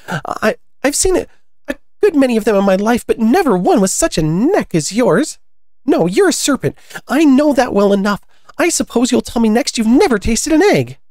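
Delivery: high, loud bird character; high-pitched storybook scorn; scornful pigeon. Acted. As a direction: accuse in a high, insistent pigeon voice.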